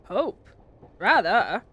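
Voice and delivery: loud, hearty fatherly reassurance; cheerful. A narrator's reading. Father reassures his son about the broken engine, loud and cheerful. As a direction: boom it out cheerfully. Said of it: "said Father cheerfully".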